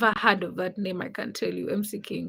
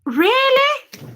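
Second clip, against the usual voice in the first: surprised squeak; 14 semitones higher, 6 dB louder than usual. Real, not acted. Reacting to chat, sudden high squeak.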